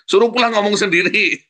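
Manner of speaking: loud, high-pitched command; mock shouted order. Parent telling child to fetch spouse, mock shouting.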